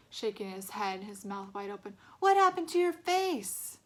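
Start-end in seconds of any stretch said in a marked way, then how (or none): 2.2-3.8 mocking. A narrator's reading. Bully mocking.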